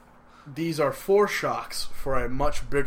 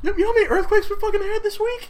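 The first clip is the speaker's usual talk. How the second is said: incredulous outburst; 5 dB louder, faster than usual. Real, not acted. Outraged about the earthquakes.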